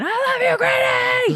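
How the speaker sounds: high excited fan shout. Retold or imitated. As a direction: yell it like a fan at a concert. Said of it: "You can hear Jeff in the background".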